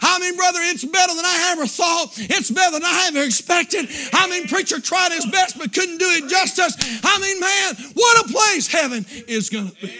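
fervent full-voiced revival climax; exultant pulpit climax; impassioned preaching. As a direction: preach it hard with driving punches.

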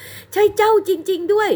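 high, slow cry of recognition; gasping as she finds him at last; surprised recognition. A narrator's reading. Bride recognises lost love. (อุทานด้วยความประหลาดใจ เสียงสูงและช้า)

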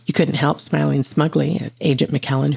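usual speaking voice

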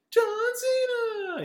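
high, slow, loud drawn-out name; mock announcer yell. Explaining the memes to co-host, slow high announcer yell. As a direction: boom the name like the meme.

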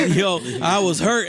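normal talking voice

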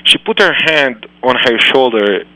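plain reading voice

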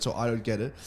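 ordinary talk, nothing marked

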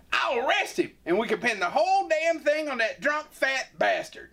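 very high conniving sheriff; high scheming voice. Acted. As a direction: plot it out up high.

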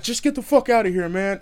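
fast, high, loud retold anger; heated brush-off, acted; angry dismissal. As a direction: imitate a fed-up man sending someone off. Angry cyclist's quote.

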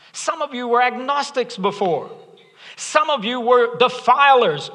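high-pitched, urgent sermon delivery; impassioned preaching. Real, not acted. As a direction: press the challenge home to the congregation. Preaches to congregation.

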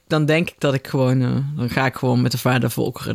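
ordinary talk, nothing marked

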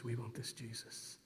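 very soft, low retold prayer; hushed prayer. Couple putting hands up at the stadium, hushed prayer. Retelling his first prayer with Sharon.

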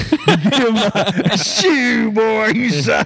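put-on country boy voice. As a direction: ham up a country boy, laughing through it. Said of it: "I'm gonna be like".

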